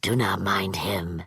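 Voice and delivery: put-on low, secret whisper to the boy. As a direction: lean in and whisper it.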